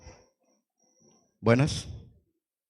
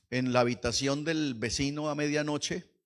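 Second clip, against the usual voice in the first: normal talking voice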